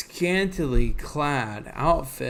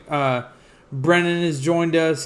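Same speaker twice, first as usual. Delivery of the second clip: ordinary talk, nothing marked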